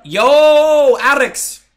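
loud, high drawn-out call. Real, not acted. Streamer hailing the player Alex, loud call. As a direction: holler a greeting across the lobby.

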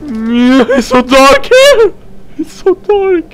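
high, slow, drawn-out complaint; frightened whine. Real, not acted. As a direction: wail it out in fear of the dark. Scared in dark game area.

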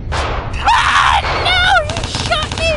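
loud, very high outcry; shrieking game reaction; alarmed scream. Real, not acted. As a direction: scream in alarm at being hit. Shot in the game.

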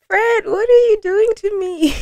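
high, giddy laughing squeal; swooning squeal. Real, not acted. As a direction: gush over the song, laughing. Swooning reaction to love song.

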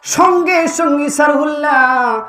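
high, loud, ringing sermon cry; impassioned preacher's shout; full-force preaching. Real, not acted. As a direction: proclaim it loudly with long-held syllables.

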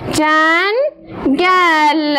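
جنگل drawn out slowly with its final ل held clearly; slow stretched word. Shown as a demonstration. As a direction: stretch جنگل and hold the last ل. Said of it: "در آخرش تلفظ کردم". (کشیده گفتن جنگل با تأکید بر لام آخر)

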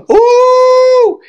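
very high, loud excited whoop; delighted whoop. Real, not acted. Comic whooping with glee at Trump's line. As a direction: whoop like the crowd went wild. Excited reaction after laugh.

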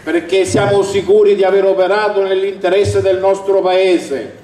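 ordinary talk

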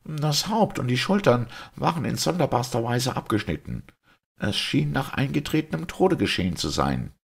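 high-pitched, impatient statement; curt, impatient. A narrator's reading. Simon puts his question from the chair, curt and impatient. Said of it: "Der ungeduldige Simon sank in einen Stuhl und sagte kurz".

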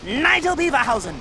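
pompous documentary host; TV presenter. Acted. Nigel opening his live broadcast, pompous presenter voice. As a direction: announce it grandly to the cameras.

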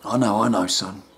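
a deep, calm therapist talking down; deep soothing counsellor; rehab counsellor. Acted.

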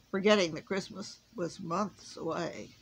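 ordinary delivery

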